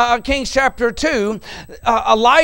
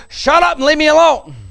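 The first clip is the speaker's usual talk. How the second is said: loud, higher, snapping Elisha telling prophets off; irritated brush-off; 8 semitones higher, 6 dB louder than usual. Retold or imitated. Elisha to the school of prophets, loud irritated brush-off. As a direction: bark it at the nagging prophets. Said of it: "I'm gonna paraphrase in modern my English".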